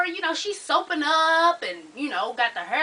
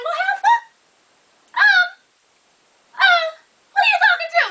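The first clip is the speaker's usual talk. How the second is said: very high, sputtering disbelief; mock outraged parent; 5 dB louder than usual. Parent challenging a daughter's nerve, sputtering mock outrage. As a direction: stammer in squealing mock outrage, building louder.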